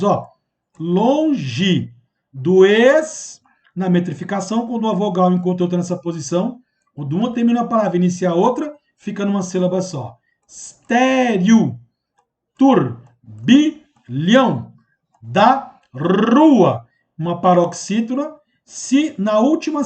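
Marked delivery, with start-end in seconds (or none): 0.8-3.4 "longe do ex" muito lento, sílaba por sílaba; escansão do verso. Shown as a demonstration. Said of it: "Quer fazer a metrificação dos versos?". 10.5-16.8 "Estéreo, turbilhão da rua" em sílabas isoladas, fortes e lentas; escansão do verso. Shown as a demonstration. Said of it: "Quer fazer a metrificação dos versos?".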